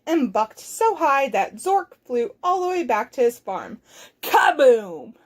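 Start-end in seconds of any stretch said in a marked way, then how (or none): 4.2-5.1 punched, falling boom; booming crash effect. Acted. Zork crashes back on his farm, booming.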